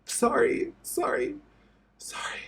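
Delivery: breathless, whispered apologies through tears; tearful whispered sorry. Real, not acted. Breaking down on camera, whispering sorry through real tears.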